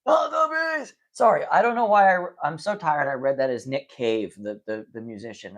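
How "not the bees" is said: high, slow, cracked mock scream; mock-screaming like Nicolas Cage, very high; Nicolas Cage impression. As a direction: ham up the panicked Nic Cage cry. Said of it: "Nick Cage is legit".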